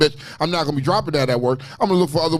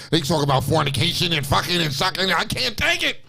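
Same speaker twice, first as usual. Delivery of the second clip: exasperated dad; 5 semitones higher than usual. Retold or imitated. Dad complaining about the show, mock exasperated. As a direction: pitch climbing into mock exasperation. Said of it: "my dad is like".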